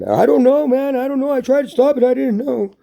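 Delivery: very high, hammed-up excuse; high-pitched frantic denial, acted; playing innocent. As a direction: plead ignorance in a mock panic. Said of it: "They'll play that card".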